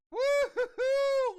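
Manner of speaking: laughing whoop. Real, not acted. Streamer whooping at a rare card pull, laughing.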